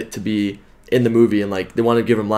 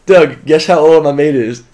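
loud, slow, bad British accent; attempted British accent; 4 semitones higher, 7 dB louder, slower than usual. American repeats the fan's question in hammed-up British accent. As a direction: exaggerate a faked British accent, higher. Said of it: "Is that your British accent?".

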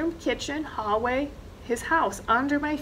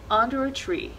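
plain reading voice

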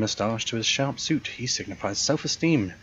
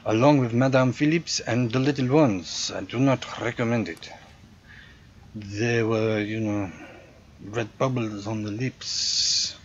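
put-on generic European accent; 2 semitones higher than usual. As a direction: play the proud hotel concierge with a thick generic European accent. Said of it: "my generic European accent".

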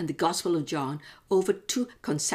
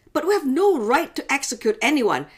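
objecting leaders; 8 semitones higher, 4 dB louder than usual. Acted. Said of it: "they objected".

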